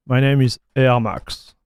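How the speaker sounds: normal talking voice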